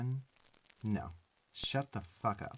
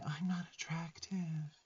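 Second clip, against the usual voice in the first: slow, breathless ghostly whisper, pitched up; mock tragic whisper; 6 semitones higher, 5 dB quieter than usual. Teasing the listener's self-pity with a mock tragic whisper. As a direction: imitate a sad little ghost sighing it out. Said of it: "tragic little Victorian ghost whispering".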